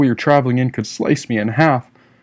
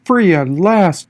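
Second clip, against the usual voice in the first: joyful cry; 6 semitones higher, 4 dB louder, slower than usual. A narrator's reading. Narrator escaping to freedom.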